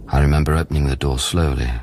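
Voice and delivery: ordinary delivery, nothing marked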